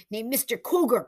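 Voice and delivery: mild urgent cry. Acted. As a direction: blurt the name urgently. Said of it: "cried Hank".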